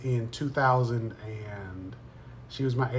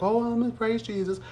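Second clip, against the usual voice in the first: mock pious; 9 semitones higher than usual. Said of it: "I was just like".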